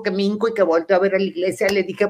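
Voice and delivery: usual speaking voice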